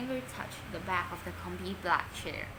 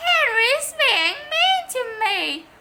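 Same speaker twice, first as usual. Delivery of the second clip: put-on whiny child; 10 dB louder than usual. A narrator's reading. Boy complaining to his mother.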